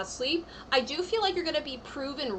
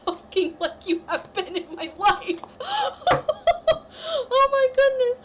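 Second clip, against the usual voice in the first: laughing, much higher and louder; real laughter; 12 semitones higher, 6 dB louder than usual. Real, not acted. Reader cracking up at a viewer's comment, laughing high and loud. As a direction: burst out laughing mid-sentence.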